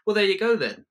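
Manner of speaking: high, loud chipper partner voice; brisk partner. Retold or imitated. Partner brushing you off, loud and chipper. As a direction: toss it off high and bright.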